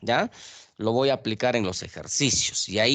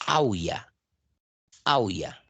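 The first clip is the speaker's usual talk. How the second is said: "aulla" said as a-Ú-ya, the u stronger than the a; stress on U; 3 semitones lower, slower than usual. Shown as a demonstration. Teacher testing which vowel sounds stronger, "aulla" stressed on the u. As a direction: lean on the u in "aulla". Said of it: "Cuál es la vocal que está sonando más fuerte".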